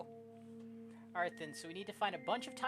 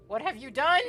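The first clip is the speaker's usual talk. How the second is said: loud, high, horrified demand; alarmed shout; 7 semitones higher, 14 dB louder than usual. Acted. Character confronting someone over what happened, alarmed shout. Acted shocked line in game.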